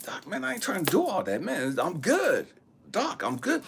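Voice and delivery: high-pitched retold objection; protesting. Patient refusing the doctor's tests, high insistent protest.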